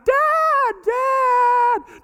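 put-on very high, loud child wail; crying child calling. Daughter being hit calls her father, high wailing. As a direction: shriek for Dad to help.

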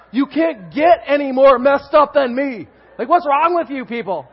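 loud, high-pitched comic outrage; exasperated protest. Retold or imitated. Messed-up new convert arguing with the pastor, exasperated.

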